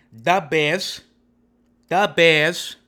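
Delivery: loud, drawn-out Chicago accent. Shown as a demonstration. Showing off a Chicago accent to viewers, Chicago accent. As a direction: punch it out slowly in a Chicago accent. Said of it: "That's my Chicago accent".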